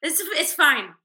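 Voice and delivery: high, breezy, nonchalant; breezy reassurance. Retold or imitated. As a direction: lightly play it cool. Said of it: "And I was like".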